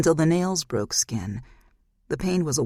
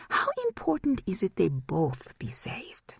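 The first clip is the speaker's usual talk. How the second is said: high whispered probing; high eerie whisper; hushed demon voice; 5 semitones higher, 3 dB quieter than usual. Acted. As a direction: probe her like a sly demon. Demon probes.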